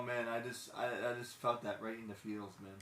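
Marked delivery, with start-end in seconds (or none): none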